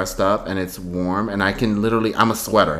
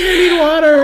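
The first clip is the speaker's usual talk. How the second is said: high whiny cry for water; desperate plea; 4 dB louder than usual. Retold or imitated. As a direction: beg like a dried-up mermaid. Mock mermaid plea.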